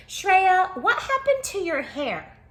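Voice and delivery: calling out. Acted. Imagined question from a classmate.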